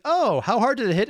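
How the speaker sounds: high, loud imitation of the host; mock eager question. Reactor replaying the video's delay, mock eager. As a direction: mimic an excited build-up. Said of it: "this little delay here is like".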